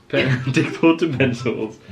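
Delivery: usual speaking voice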